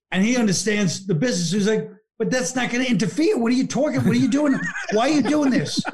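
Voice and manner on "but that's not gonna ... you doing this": high-pitched agitated pestering; mock-exasperated questioning in a raised pitch; agitated retold protest. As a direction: imitate someone protesting, pitched well up. Acted argument over being busy.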